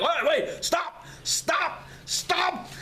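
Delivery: the woken monk shouting to stop them, high; frantic shout. Acted. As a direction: yell at him to stop. Monk waking, trying to stop them.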